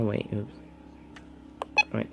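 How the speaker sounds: ordinary delivery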